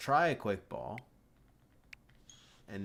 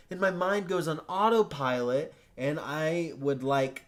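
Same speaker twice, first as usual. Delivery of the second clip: frustrated rant; 5 semitones higher, 7 dB louder than usual. Real, not acted. Raging about resetting the game.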